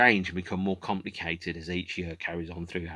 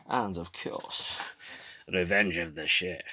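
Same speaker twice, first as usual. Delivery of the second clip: put-on slurred Scottish accent, S turned to SH; Sean Connery; slower than usual. Film fan listing the movies, mock Scottish star accent. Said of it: "in a Sean Connery accent".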